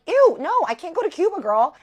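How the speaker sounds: high, loud acted-out refusal; disgusted protest. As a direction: wail it at a friend in mock horror. Retold disgusted refusal to friend.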